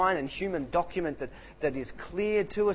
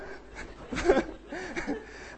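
a high unvoiced giggle; high breathy laugh; 13 semitones higher, 3 dB quieter than usual. Real, not acted. Preacher laughing at his own impression, high and breathy. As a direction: let a breathy laugh escape.

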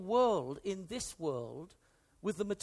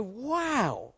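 awed wow. Retold or imitated. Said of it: "and you go".

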